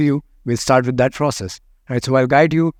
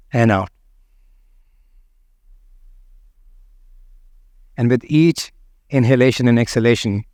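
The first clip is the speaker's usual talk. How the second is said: right in your ear. Acted. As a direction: lead the breath gently, close to the listener. Starts the guided meditation.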